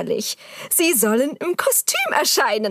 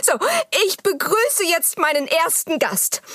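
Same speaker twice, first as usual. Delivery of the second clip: normal talking voice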